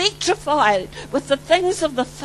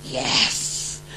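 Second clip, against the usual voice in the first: whispered yes; 13 semitones lower, 6 dB quieter than usual. Retold or imitated.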